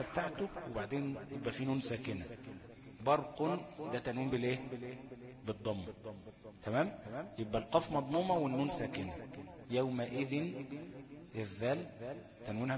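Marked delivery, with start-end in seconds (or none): none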